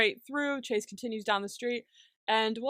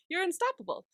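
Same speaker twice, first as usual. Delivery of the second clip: usual speaking voice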